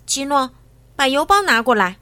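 loud, firm order; calling out. A narrator's reading. Friend summoning Guido for the mailbag, calling out loudly. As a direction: call over a helper with a loud command.

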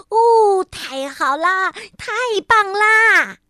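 high, loud, drawn-out cheer. A narrator's reading. Frog cheering as the snowball grows, joyful slow cheer. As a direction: cheer it out with joy. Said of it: "跳跳欢呼起来".

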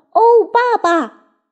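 high, drawn-out cry to dad; loud excited call. A narrator's reading. Littlest fox calling to his dad about a find, loud excited call. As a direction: call out high and long, thrilled. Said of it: "他大声说".

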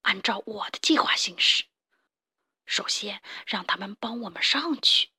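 hushed, conspiring gang boss; scheming mole boss. Acted. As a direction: lay out the plan in a hushed villain voice. Villain boss giving orders.

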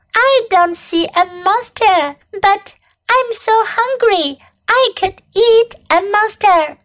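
high-pitched little bug voice; hungry baby bug. Acted. Baby bug boasts of her hunger, high voice.

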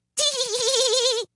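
very high, drawn-out titter; little woman's high tittering laugh; irrepressible giggle. A narrator's reading. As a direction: let a tee-hee burst out. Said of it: "could not keep from laughing".

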